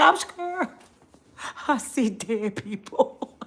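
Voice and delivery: slow, hushed mock fear; mock scared Elon. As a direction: play him as a frightened kid. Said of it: "He said".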